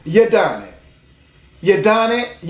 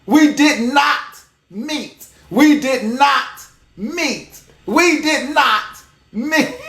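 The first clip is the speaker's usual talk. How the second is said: "We did not meet." slow, each word apart; slow, spaced sound bite; separated words; slower than usual. Shown as a demonstration. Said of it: "let that be the sound bite".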